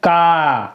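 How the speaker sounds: का with a long, held aa; long आ sound. Shown as a demonstration. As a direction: hold the vowel in का as a teacher's model. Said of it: "बड़े आ की मात्रा लगा दी तो". (का में लंबा आ)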